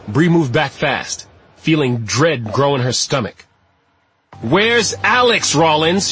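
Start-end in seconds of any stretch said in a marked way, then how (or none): 4.4-6.1 slow, high, drawn-out shout; raised, desperate questioning; shouted demand. A narrator's reading. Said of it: "she shouted".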